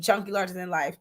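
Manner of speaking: quick, run-together name in passing; said really fast. Real, not acted. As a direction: rattle it off quickly. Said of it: "You said that really fast".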